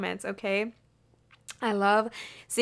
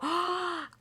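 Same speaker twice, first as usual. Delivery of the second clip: sharp, high intake of breath; excited gasp; 7 semitones higher, 5 dB quieter than usual. Real, not acted. Reader gasps at a lucky card, thrilled. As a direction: gasp with delight.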